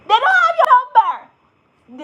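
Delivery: high, loud, slow mock exclamation; exasperated outburst. Real, not acted. Telling viewers about empty callbacks, loud exasperated outburst. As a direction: throw up your hands, high and loud.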